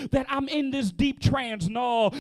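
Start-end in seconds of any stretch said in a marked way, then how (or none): none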